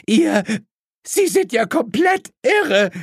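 very high, hysterical woman's shriek; screeching. A narrator's reading. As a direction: shriek it at them, outraged. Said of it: "kreischte Misses Cordial". (hysterisch kreischend)

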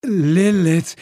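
groaned name; groaning. Acted. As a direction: groan it out in dread. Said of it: "ächzte ich". (ächzend)

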